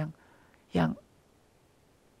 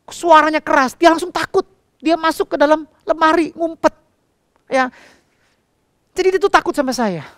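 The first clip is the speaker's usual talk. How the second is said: high, loud preacher's retelling; full-force preaching; 10 semitones higher, 7 dB louder than usual. Acted. Pastor telling the church about his daughter, preaching loudly. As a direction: preach the story out to the congregation.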